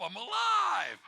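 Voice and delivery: sudden high shout of relief; startled yell on waking. Acted. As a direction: jolt awake and yell it out. Waking up, quoted thought.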